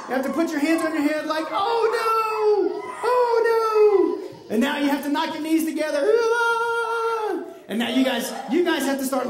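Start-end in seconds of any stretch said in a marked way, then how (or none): 1.5-4.5 high, drawn-out mock wail; hammed-up cry of alarm; acted fear. Showing officials with hands on heads, acted fear. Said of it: "act like you're afraid". 6.1-7.4 long, high mock-scared "ah"; sustained cry of pretend terror; frightened wail. Officials knocking knees together, acted frightened wail. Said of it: "act like you're afraid".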